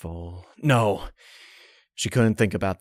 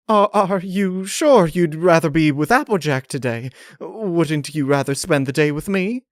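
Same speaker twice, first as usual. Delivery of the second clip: higher, strained pleading lady; Rarity voice; 7 semitones higher, 4 dB louder than usual. Acted. Rarity pleading with Twilight to stay, higher and strained. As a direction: voice Rarity, composure slipping.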